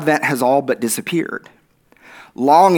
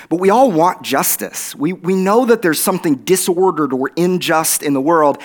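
usual speaking voice

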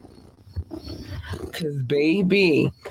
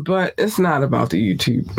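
ordinary talk, nothing marked

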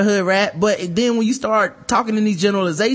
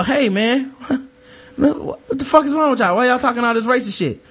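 mock indignant; 4 semitones higher than usual. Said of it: "and you go".

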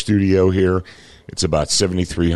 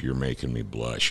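softer, under the breath; quiet repeat; 4 semitones lower, 7 dB quieter than usual. Real, not acted. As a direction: mutter it again to yourself.

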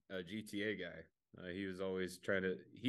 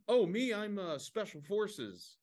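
higher voice, special forces drawled out slowly; a proud fake soldier voice, pitched up and slow; a fake soldier bragging to his questioner, pitched-up slow voice; 10 semitones higher, slower than usual. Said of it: "And he's like".

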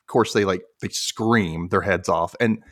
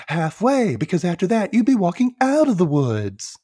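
raised, high-pitched character voice; mock know-it-all answer; 9 semitones higher than usual. Buzz answers the riddle, high and put-on.

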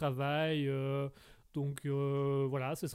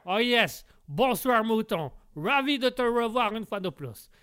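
loud, hammed-up American speaking French; American accent; 4 dB louder than usual. American character greets a loyal listener, hammed-up American accent. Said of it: "Bonsoir l'Américain".

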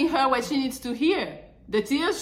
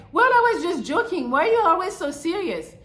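higher and louder, brushing it off; higher, louder mock of a girlfriend saying she was joking; mock dismissive girlfriend; 8 semitones higher, 4 dB louder than usual. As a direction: imitate her shrugging off the hurt. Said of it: "she'd be like".

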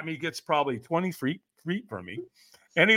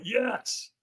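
timid yes; 6 semitones higher, 5 dB quieter than usual. Retold or imitated. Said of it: "And I went".

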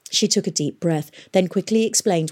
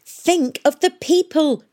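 high, slow, emphatic reproach; angry; 11 semitones higher, 5 dB louder, slower than usual. A narrator's reading. Catherine rebukes historians for forgetting people, angrily. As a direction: scold them, louder and drawn out. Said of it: "said Catherine angrily".

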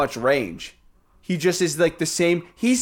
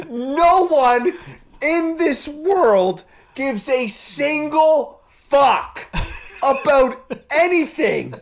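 ranting; 7 semitones higher, much slower than usual. Real, not acted. Ranting about TikTok trends.

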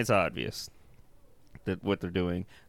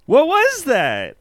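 baffled; 12 semitones higher, 6 dB louder, slower than usual. Real, not acted. Baffled by the film scene.

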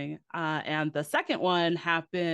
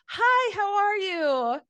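much higher, louder, slow greeting; customer service voice; 6 dB louder, much slower than usual. Shown as a demonstration. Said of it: "Like, your voice changes".

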